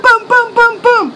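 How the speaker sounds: punchy mock booms on the door; imitated banging. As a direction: knock out each boom.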